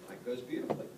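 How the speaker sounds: ordinary talk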